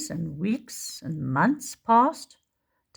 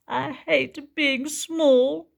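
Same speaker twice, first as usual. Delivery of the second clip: sulky Tiny; 8 semitones higher, 4 dB louder, much slower than usual. Acted. Tiny sulks alone.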